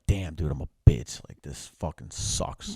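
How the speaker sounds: self-pity. Retold or imitated. Self-pity after the loss.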